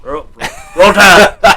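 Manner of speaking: high, loud rallying shout; team cheer. Pretend. Fan cheering a football team to a friend, playful shout. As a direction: belt out the college cheer.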